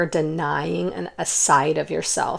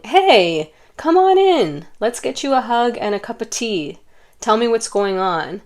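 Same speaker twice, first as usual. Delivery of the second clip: warm welcome; 5 semitones higher than usual. Acted. Saying to a crying friend on the doorstep, warm welcome. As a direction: invite them in kindly, pitched up high.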